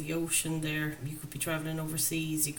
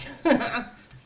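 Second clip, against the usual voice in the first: high, loud burst of laughter; real amused laugh; 6 semitones higher, 9 dB louder than usual. Real, not acted. Reader amused by a very tempting card, laughing.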